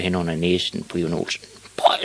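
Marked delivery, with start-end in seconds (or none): 1.8-2.0 spluttered "pøj" of revulsion; disgusted retch. Acted. Ivan tasting the witch's soup, spluttering in disgust. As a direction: splutter out the awful taste. Boy swallows horrible soup.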